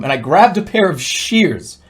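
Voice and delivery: plain reading voice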